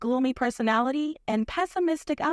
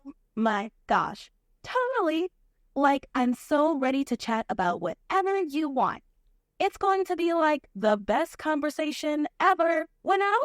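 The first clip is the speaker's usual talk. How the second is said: put-on Valley Girl accent. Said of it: "a Valley Girl accent".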